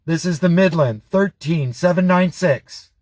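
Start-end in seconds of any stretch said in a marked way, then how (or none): none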